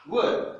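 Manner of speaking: Word, dit en anglais, voix plus haute; English model word. Shown as a demonstration. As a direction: prononcer Word à l'anglaise, net. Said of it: "Word, c'est le mot". (Word prononcé à l'anglaise, plus haut)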